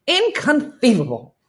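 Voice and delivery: high, loud theatrical inconceivable; hammed-up movie inconceivable; Princess Bride quote. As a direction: bust out the movie line with flair. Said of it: "I just busted out The Princess Bride".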